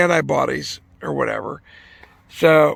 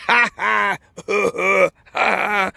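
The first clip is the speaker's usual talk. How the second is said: higher-pitched mock laugh; imitated laughter; 5 semitones higher, slower than usual. Patient getting a nose swab, imitated laughing fit. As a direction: act out helpless ha-ha laughing in short bursts. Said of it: "I start fucking laughing".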